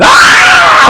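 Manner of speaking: very high, drawn-out mock bawling; acted-out crying scream; imitated loud wail. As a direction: wail out as in bitter weeping. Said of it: "엄청나게 울었던 거예요".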